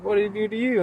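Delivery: high, loud retold query; urgent question. Liquor store guy asks the driver what happened, urgent. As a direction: imitate a worked-up onlooker.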